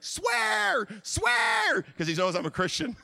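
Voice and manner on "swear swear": high, loud, drawn-out egging-on; goading shout. Retold or imitated. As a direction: yell it like a coworker daring him. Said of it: "He's like".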